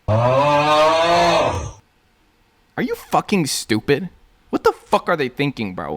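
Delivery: loud, high disbelieving yell; outraged outburst. Real, not acted. Fed-up fan yelling at the witch plot, loud and high. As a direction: blow up at the absurd plot.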